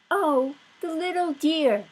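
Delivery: high, tender coo. Acted. Wife cooing over the piglet, high and tender. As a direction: coo over the little dear. Mrs Watson coos.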